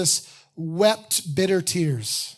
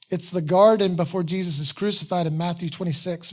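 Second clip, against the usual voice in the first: ordinary delivery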